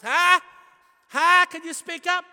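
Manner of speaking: put-on very high, loud old-aunt holler; hard-of-hearing shout. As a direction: shout like a deaf old relative demanding volume.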